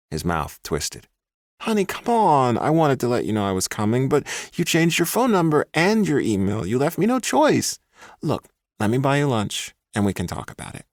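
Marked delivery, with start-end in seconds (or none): 1.6-10.8 much higher, wheedling man's voice. A narrator's reading. Ex boyfriend wheedling the woman he stalked. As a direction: sweet-talk her into lunch.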